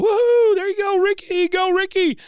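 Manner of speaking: loud high whooping cheer; cheering from the stands. Retold or imitated. Bill cheers Ricky from the stands: loud high whoop. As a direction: whoop for Ricky at the top of your voice. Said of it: "you hear Bill go".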